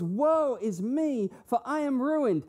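loud, high cry of woe, drawn out; anguished lament. Acted. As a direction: wail it slowly as a man undone. Isaiah's anguished cry, acted by preacher.

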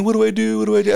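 mock panic. Football player facing the injured installer, hammed-up panic. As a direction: imitate a big guy panicking in a high voice. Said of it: "he starts running around panicking".

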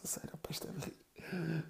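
a hushed giggly whisper; whisper, amused; whispered mother. Retold or imitated. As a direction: whisper it like a mother holding back laughter. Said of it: "ela começa a contar".

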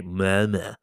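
Malmö in a put-on Skånsk accent; attempted Skånsk pronunciation of Malmö; best Skånsk accent. Shown as a demonstration. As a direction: say Malmö like a local from Skåne, in Skånsk. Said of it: "That was my best Skånsk accent".

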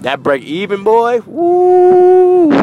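high, loud slow hype with a whoop; bragging about his karaoke song, loud whoop. Acted.

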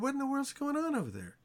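put-on older son asking a servant, surprised. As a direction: sound baffled by the noise. Older son hears the party.

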